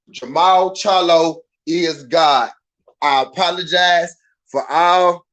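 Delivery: slow, loud, deliberate apology; solemn declaration. Shown as a demonstration. Streamer dictating an apology to a bettor, slow and emphatic. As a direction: spell out each word like a public oath. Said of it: "say it just like that".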